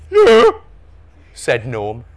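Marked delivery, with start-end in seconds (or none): 0.1-0.5 high, loud single blurt; obvious-answer retort. A narrator's reading. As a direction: toss it back as blindingly obvious. Son answers a stupid question.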